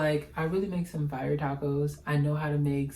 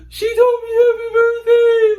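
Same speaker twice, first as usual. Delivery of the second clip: much higher and louder, squealing with joy; excited shriek; 13 dB louder than usual. Retold or imitated. Teen fan screaming that Drea Michelle wished her happy birthday, excited shriek. Reliving idol's birthday wish.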